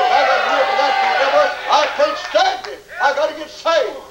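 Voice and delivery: high, desperate acted plea; impassioned retold outcry, pitched high; crying out. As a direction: imitate a convicted sinner at breaking point. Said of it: "and he cried out".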